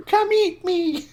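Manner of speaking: high, loud, laughing tempting voice; a tiny biscuit calling out, laughing. Retold or imitated.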